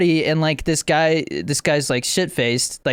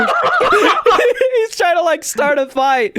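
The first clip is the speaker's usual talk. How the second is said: ordinary delivery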